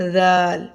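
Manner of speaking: زال with a gentle th as in "this"; soft dhal. Shown as a demonstration. Teacher contrasts two letters, soft lisped زال. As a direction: hold زال long, no whistle. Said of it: "بہت نرمی سے پڑھا جائے گا".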